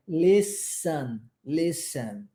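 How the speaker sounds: "Listen" with no T, S straight into E; slow, careful "Listen", the T left silent. Shown as a demonstration. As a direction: go from S to the vowel in "Listen". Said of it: "Nós não pronunciamos a letra T".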